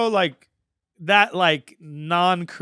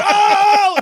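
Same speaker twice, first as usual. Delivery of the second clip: very high, loud whooping "oh"; crowd roar "oh"; 9 dB louder than usual. Retold or imitated. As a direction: howl like a crowd going wild. Crowd erupting in battle.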